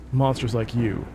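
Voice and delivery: usual speaking voice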